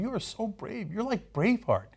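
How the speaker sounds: a hint of Daryl buttering him up; Daryl, mock praise. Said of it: "Daryl says to him".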